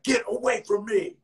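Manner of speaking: mock angry brush-off; pissed off thug. Retelling the thug who turned on him, mock angry. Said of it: "the most pissed off person".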